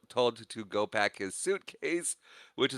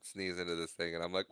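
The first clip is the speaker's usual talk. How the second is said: normal talking voice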